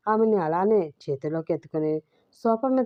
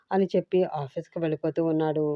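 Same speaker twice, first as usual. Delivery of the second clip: usual speaking voice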